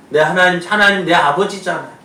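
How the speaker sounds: full-force preaching. Acted. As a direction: preach the bold prayer at full voice. Preacher voices bold prayer loudly.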